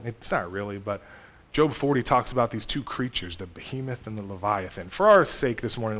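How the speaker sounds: ordinary delivery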